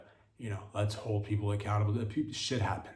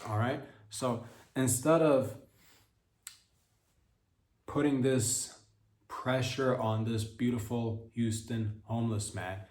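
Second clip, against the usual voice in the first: ordinary talk, nothing marked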